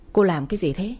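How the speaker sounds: usual speaking voice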